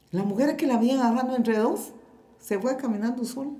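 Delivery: normal talking voice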